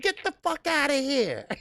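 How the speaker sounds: high, laughing, mock-outraged brush-off; giggling get-out-of-here; laughing retort. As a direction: toss it off through laughter, way up high. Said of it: "I said".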